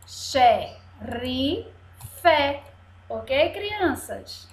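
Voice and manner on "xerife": "Xerife" separated into xe, ri, fe; syllable by syllable. Shown as a demonstration. Teacher spells out for children, "Xerife" broken up. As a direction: leave a gap after each syllable of "Xerife". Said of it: "Vamos soletrar".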